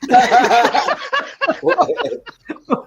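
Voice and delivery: high, loud burst of laughter; explosive laughter. Real, not acted. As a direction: crack up loudly at a punchline. Listener cracks up at punchline.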